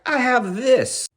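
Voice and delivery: very high, slow and drawn out; high gleeful drawn-out claim; excited. Retold or imitated. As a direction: savor the win, gleeful. Jim triumphant after realizing.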